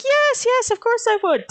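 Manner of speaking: high, eager mock agreement with a teasing tone; Ava's voice. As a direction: chirp it like an overeager Ava trainer. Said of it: "And Ava's just like".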